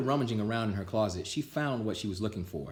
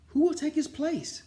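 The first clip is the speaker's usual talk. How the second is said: put-on high, louder anxious kids' voice; worried kids; 4 dB louder than usual. A narrator's reading. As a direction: ask it like fretful schoolkids. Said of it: "said the cl-, kids".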